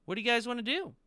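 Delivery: high-pitched yelling scout character; Tony. Acted. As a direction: yell your report back in a very high voice. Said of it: "he sorta yells back".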